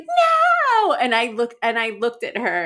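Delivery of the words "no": put-on child's shout. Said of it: "Louise was like".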